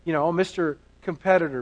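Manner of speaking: normal talking voice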